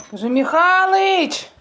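loud, high call across the yard; calling husband outdoors, loud and high. Real, not acted. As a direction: shout his name out to him.

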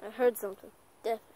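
the same line dropped to a hush; hushed and wary, listening for the noise. Acted. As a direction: say it softly so nothing hears. Hearing a noise while hunting.

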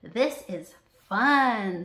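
slow, loud, stretched delight; gleeful. A narrator's reading. Sarah flying with friends, slow gleeful delight. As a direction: savour the fun slowly. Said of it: "Sarah adds with a glint".

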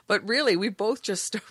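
ordinary talk, nothing marked

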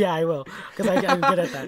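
loud reply broken up by laughter; laughing. Real, not acted. Answers a friend's teasing about interrupting himself, laughing loudly. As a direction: laugh hard while owning up to it.

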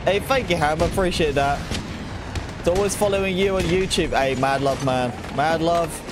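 usual speaking voice

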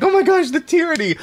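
hammed-up high adoring cry; mock dramatic wail. Shown as a demonstration. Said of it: "it's like".

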